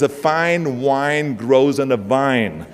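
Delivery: slow, careful "fine wine ... vine"; V and W drill. Acted. Austrian explaining mixed-up letters to audience, slow pronunciation drill. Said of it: "difficult time with the V".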